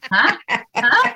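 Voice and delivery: high-pitched baffled "huh"; mock bafflement. Trainer told her accent will win people over, mock bafflement. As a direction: squeak it out in comic disbelief. Acting out her puzzled reaction.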